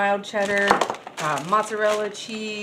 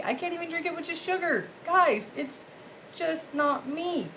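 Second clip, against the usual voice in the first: exasperated; 9 semitones higher than usual. Real, not acted.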